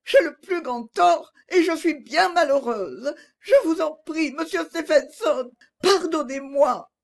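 high tearful plea with sobs; crying. A narrator's reading. Agnès, sobbing, begs Stephenson to forgive her. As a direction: beg forgiveness through tears, voice high. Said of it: "reprit Agnès en pleurant encore plus fort".

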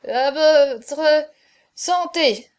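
loud, halting, broken-up toast; drunken stammer. A narrator's reading. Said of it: "balbutia Chaverny".

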